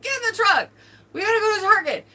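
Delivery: high, loud hurried call; urgent mock yell. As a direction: hurry him out the door, yelling. Cousin urging husband to hurry.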